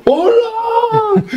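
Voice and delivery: very high, sing-song hello; cheery hello. Retold or imitated. New student cheerfully greeting the only Colombian he knows, very high sing-song. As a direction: greet him like a long-lost friend.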